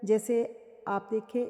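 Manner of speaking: usual speaking voice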